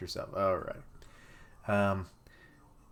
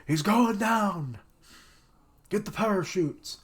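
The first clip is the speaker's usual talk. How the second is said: mock alarm; 10 semitones higher, 4 dB louder than usual. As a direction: play the panicked pilot yelling. Reacting to jet getting hit.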